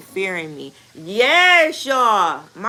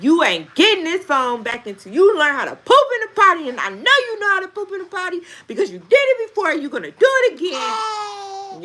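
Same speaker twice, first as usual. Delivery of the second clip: high, loud mock scolding; retold fussing; 11 semitones higher, 5 dB louder than usual. Mom retells fussing at son over potty, high heated scolding. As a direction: fuss hard, re-enacting a mother's ultimatum. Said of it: "I fussed so hard at him".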